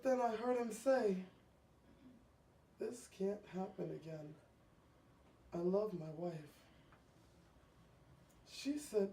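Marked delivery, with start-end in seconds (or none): 2.8-6.3 hushed. Acted. Husband voiced in story.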